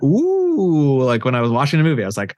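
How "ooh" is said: impressed ooh. Retold or imitated. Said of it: "I literally went".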